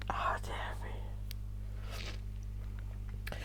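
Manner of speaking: tearful whisper. Real, not acted. Crying on camera, sighing.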